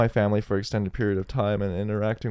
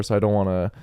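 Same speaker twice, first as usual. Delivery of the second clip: usual speaking voice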